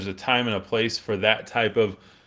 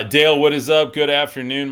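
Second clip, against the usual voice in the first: calling out a cheerful hello; big upbeat shout-out; loud greeting; 5 semitones higher, 8 dB louder than usual. Real, not acted. Greeting a live viewer.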